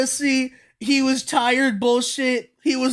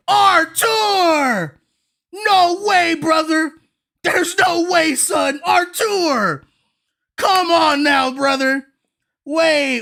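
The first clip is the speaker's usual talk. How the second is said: frantic calling; slower than usual. Real, not acted. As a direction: yell for a friend who has gone silent. Shouting for viewer in chat.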